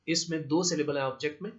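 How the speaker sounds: ordinary talk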